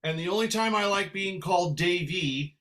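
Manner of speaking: ordinary talk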